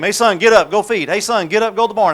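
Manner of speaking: loud father calling his son up; dad hollering to rouse a sleepy son. Retold or imitated. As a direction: shout up the stairs to wake the boy. Dad waking son for chores.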